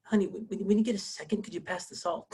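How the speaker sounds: high, hammed-up gentle request, softening to the end; mock-delicate husband asking his wife, trailing into softness; exaggerated politeness. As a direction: tiptoe round asking for a small favor. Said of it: "I'm exaggerating here a little bit".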